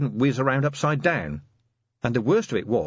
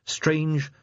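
plain reading voice

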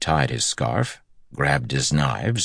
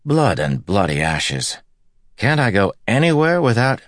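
exasperated groan; 7 semitones higher, 4 dB louder than usual. Acted. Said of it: "Matt groaned".

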